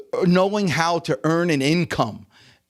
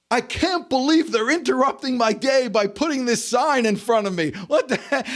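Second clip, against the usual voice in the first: higher-pitched hammed-up indignation; mock outrage; 8 semitones higher than usual. Coach mimics an imaginary driver annoyed by a billboard, mock outrage. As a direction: complain in exaggerated disbelief at the nerve of it.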